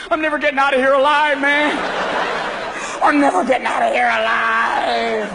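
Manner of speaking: stuck and panicking; paranoid golfer. Acted. Paranoid golfer stuck in the sand trap, panicky.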